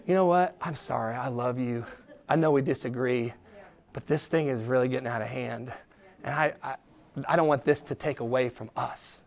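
low, gentle apology; soft answer. Acted. First person to back down in an argument, gently apologetic. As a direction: defuse the fight softly and sincerely. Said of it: "A soft answer turns away wrath".

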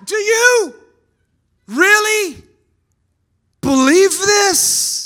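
high, loud, slow with really stretched; shouted challenge. Real, not acted. Preacher shouting the question at the congregation. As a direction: challenge them at full voice. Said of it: "repeats the question to congregation".

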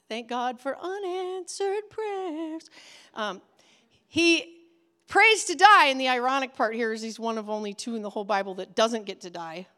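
ordinary delivery

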